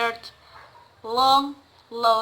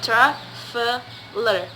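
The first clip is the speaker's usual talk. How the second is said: traveler slowly split, TRA, VE, LER; syllable by syllable; much slower than usual. Shown as a demonstration. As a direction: pause between each syllable of traveler. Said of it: "There are three syllables".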